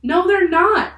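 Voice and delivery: very high, loud contradiction; crying out. A narrator's reading. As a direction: cry out in protest. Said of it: "cried Annie".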